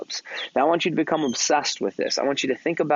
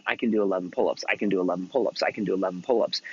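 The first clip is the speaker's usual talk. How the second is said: low, even, unvarying repetition; repeated mantra; 6 semitones lower, 3 dB quieter, faster than usual. Retold or imitated. Boy repeating his pull-up mantra over and over, chanting. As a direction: run it round like a private chant. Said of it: "say over and over again".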